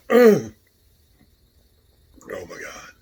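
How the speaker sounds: gasping through a burning mouth; strained, fighting the spice; mouth burning from spicy food. Real, not acted. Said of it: "it's hot".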